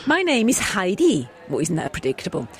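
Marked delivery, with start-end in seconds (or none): 0.1-1.3 put-on Swiss accent. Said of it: "I can't do a Swiss accent".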